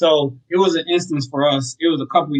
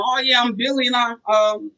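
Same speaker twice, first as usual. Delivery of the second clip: high, unsure sales-caller voice; mock a clueless caller pitching a landlord; unprepared caller; 8 semitones higher than usual. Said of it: "You can't just come on".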